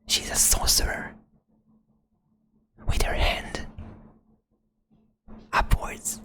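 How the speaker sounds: usual speaking voice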